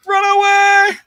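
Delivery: high, loud panicked cry; Monty Python knights fleeing. Retold or imitated. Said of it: "great quotes in Monty Python".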